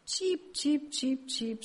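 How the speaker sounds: high joyful bird chirps; happy cheeps. Acted. Max chirps for joy, high and bright. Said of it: "zwitscherte fröhlich".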